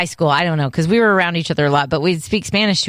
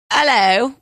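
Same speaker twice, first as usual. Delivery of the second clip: British hello; 5 semitones higher than usual. Shown as a demonstration. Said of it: "make your brain go".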